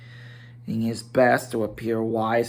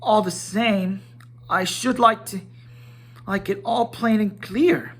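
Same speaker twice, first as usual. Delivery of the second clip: higher hobbit voice, stubborn and businesslike; a stubborn hobbit talking terms; obstinate business manner; 9 semitones higher than usual. A narrator's reading. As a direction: dig in politely but firmly. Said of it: "he said obstinately, putting on his business manner".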